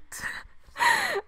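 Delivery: a high squealing giggle; high laugh. Real, not acted. Fan losing it over the game, high squeal of laughter.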